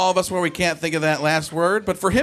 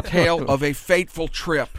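a hint of Bush quoting a TV theme; Bush impression. Comic doing Bush quoting the Gilligan's Island theme, mild impression. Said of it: "my Bush impression is like him".

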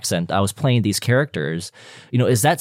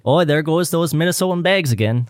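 higher, sing-song Minnesotan accent; 7 semitones higher than usual. Shown as a demonstration. Offering the guest a Minnesotan accent line, higher-pitched Minnesotan accent. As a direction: play up a folksy Minnesotan accent. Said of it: "do a Minnesotan accent".

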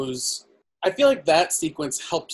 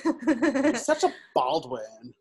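put-on slow, drawn-out Valley Girl accent; 7 semitones higher, 4 dB quieter, slower than usual. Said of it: "it's a Valley Girl accent".

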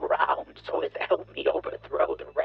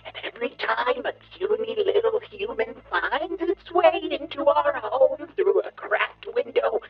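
booming megalomaniac bug ranting; evil villain rant; 7 semitones higher, 5 dB louder than usual. Acted. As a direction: roar it at the puny humans. Villain gloats over humanity.

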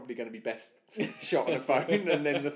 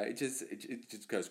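ordinary talk, nothing marked

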